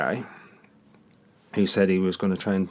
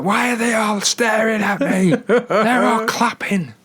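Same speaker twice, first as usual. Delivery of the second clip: high, drawn-out mock wail; hammed-up paranoid shrieking with laughter around it; imitated screaming; 13 semitones higher, 4 dB louder, slower than usual. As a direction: mimic a panicking man screeching at onlookers. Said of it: "he started screaming".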